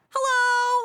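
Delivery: high, sing-song friendly hello; a neighbour chirping a pleasant hello; cheery greeting. Retold or imitated. Retelling the man greeting on camera, high cheerful voice. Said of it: "he's like".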